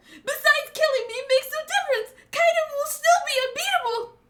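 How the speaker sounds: frantic high-pitched villain; squealing, hurried, loud bargaining; Orochi. Acted. Cowardly shogun arguing against being killed, frantic. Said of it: "Orochi's saying".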